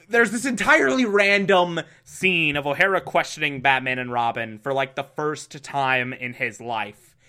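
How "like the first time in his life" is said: ordinary talk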